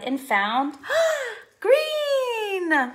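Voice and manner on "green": high gasp then joyful green; high excited exclamation; delighted gasp. Acted. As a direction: gasp with surprise, then cry out.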